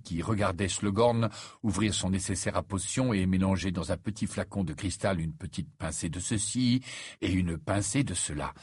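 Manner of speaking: usual speaking voice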